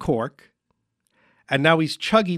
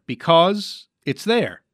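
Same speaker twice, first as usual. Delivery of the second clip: "Because it's there" drawn out slowly, word by word; slow piecing together; 3 semitones higher, slower than usual. Pretend. Host assembling the charade answer, slow and tentative.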